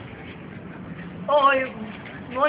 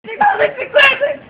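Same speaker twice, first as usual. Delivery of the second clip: shocked shout; 8 semitones higher than usual. Acted. Shocked at the missing money.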